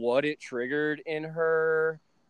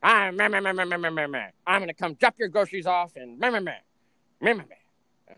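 louder, higher mock nagging; mock nagging mom; 3 semitones higher, 3 dB louder than usual. Son mocking his mom's angry phone rant, louder and higher. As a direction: parody her rant as meh meh. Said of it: "she calls me".

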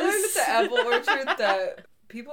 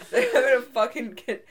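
usual speaking voice

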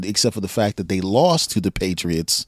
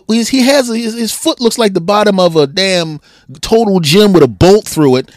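normal talking voice

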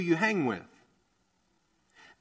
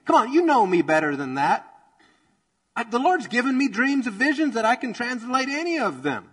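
higher and louder, offended protest; indignant outburst; 6 semitones higher, 5 dB louder than usual. Pretend. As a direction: play the wronged man who won't stand for it. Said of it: "Daniel could have been like".

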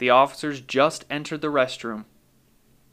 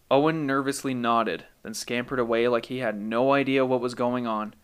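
usual speaking voice

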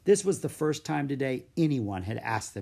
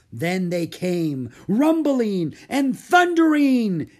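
very loud, high, slow storyteller's cry; exclaiming; 10 semitones higher, 10 dB louder, slower than usual. A narrator's reading. Mary telling the children the fairy tale, loud and thrilled. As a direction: thunder it out as the climax. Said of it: "exclaimed Mary".

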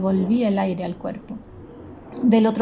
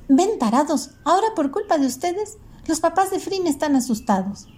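put-on high, angry girl's scolding at raised pitch; Vera yelling; 8 semitones higher than usual. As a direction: blame the others for the scare, pitched high. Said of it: "gritó Vera".

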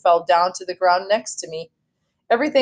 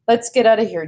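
ordinary delivery

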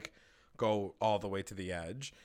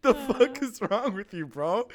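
laughing disbelief; 10 semitones higher, 5 dB louder than usual. Real, not acted. Disbelief at characters.

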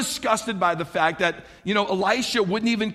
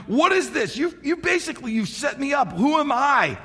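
higher-pitched mock distress; distraught; 7 semitones higher than usual. Preacher voicing the king who feels set up, mock-distraught. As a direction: protest like a rattled king, acted out. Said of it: "the king was so distraught".

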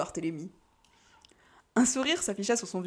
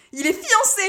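high, piercing little exclamation; piercing outburst of dismay; small piercing cry; 12 semitones higher, 7 dB louder than usual. Acted. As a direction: cry it out loud in a small, shrill voice. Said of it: "s'écria Kali d'une petite voix perçante".

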